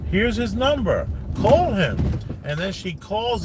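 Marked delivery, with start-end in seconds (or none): 0.1-2.0 lightly voiced producers, pitched higher; higher, lilting mock of helpful producers; producers' eager reply. Producers tell her they have his number, lightly mimicked.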